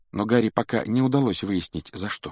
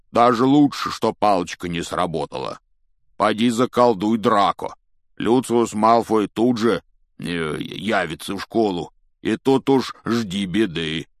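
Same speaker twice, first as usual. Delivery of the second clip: big lumbering voice; gruff giant, cautioning; 5 dB louder than usual. Acted. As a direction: warn heavily, with hesitations.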